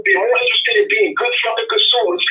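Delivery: heated rant climbing high in pitch; excited, worked-up. Real, not acted. Caller fed up with people not supporting their own, excited rant. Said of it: "I get excited".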